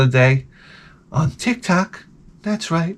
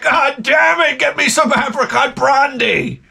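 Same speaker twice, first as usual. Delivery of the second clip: higher shouted demand for brandy; Quint yelling; 9 semitones higher than usual. Acted. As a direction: bark it out like the salty old shark hunter.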